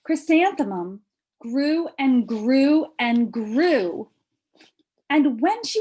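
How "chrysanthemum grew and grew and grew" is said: slow, stretched storytelling; drawn out. A narrator's reading. Reading to a child, slow and stretched. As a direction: draw out each grew as she grows. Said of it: "slow stretched storytelling".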